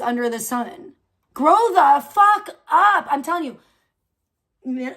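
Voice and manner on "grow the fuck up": angry scold. Real, not acted. Scolding millennials in a rant.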